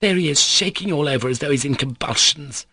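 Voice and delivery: high-pitched, scornful complaint; indignant. Acted. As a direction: point him out with outrage. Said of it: "Varvara went on indignantly".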